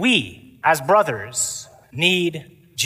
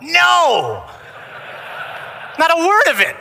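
loud, high, drawn-out heckle; yelled out; 13 semitones higher, 4 dB louder, slower than usual. Retold or imitated. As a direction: yell it like a whole chapel heckling. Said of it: "we all yelled out".

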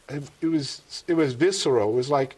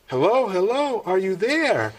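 higher, livelier comic hail; insistent phone calling; 5 semitones higher, 4 dB louder than usual. Retold or imitated. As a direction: call out to an unresponsive listener, re-enacted. I guess I wasn't too responsive.

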